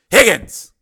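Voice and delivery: loud, high call-out; urgent call. Acted. Steele calls his teammate after the fight, loud. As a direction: call sharply for your teammate.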